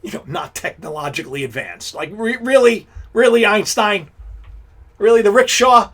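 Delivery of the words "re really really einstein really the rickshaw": loud, incredulous questioning; mock disbelief. Real, not acted. Host scolding Einstein over the rickshaw jokes, incredulous. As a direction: call him out in loud disbelief.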